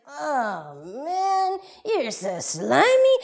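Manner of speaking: drawn-out, loud revulsion; slow, swooping yuck; disgusted boy. Acted. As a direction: groan in disgust at the slime. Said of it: "He's like".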